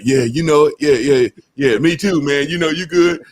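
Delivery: lightly voiced buddy shrugging it back. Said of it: "And somebody like".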